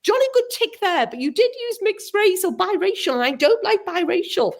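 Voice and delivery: high-pitched mimicry of a nitpicker; mock-prim objection in a high voice; mock fussy complainer. Mimics the fussy letter writer.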